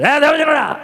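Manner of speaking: "ఏ దైవజనుడా" pitched high with irritated mockery; irritated sarcasm. Shown as a demonstration. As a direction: sneer the title sarcastically. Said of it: "చిరాగ్గా అన్నది ఎటకారంతో".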